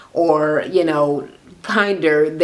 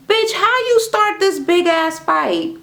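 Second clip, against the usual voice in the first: high, slow, incredulous call-out; outraged disbelief; 13 semitones higher, slower than usual. Real, not acted. Ranting at a housewife on TV, outraged high disbelief. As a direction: slam her like you can't believe the nerve.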